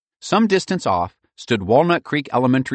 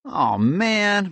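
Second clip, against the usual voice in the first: disappointed; 12 semitones higher than usual. A narrator's reading. Kid groans about chore.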